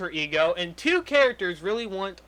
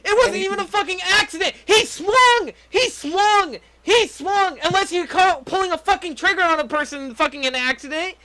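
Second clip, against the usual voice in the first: angry rant; 9 semitones higher than usual. Real, not acted. Ranting that the killer swung.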